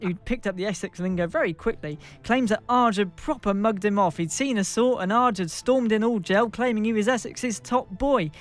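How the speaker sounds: usual speaking voice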